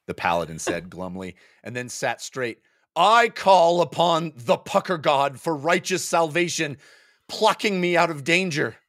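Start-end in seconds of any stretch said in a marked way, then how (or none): 3.0-8.8 mock-solemn prayer. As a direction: call on the Pucker God grandly. Paladin invokes his god.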